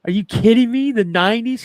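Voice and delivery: high, loud incredulous what; retold disbelief. Dad stunned his nineties clothes are vintage, high and loud. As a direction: play your own shock at vintage meaning nineties. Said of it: "I'm like".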